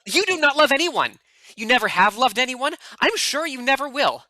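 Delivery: Giovanni furious. Acted. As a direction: hurl it at him in rage. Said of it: "you're gonna be Giovanni".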